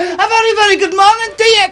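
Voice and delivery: a mock Glaswegian comic's big hello; Billy Connolly Scots. Presenter greeting the band from Scotland in a mock Scots accent. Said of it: "I'm doing my Billy Connolly impression".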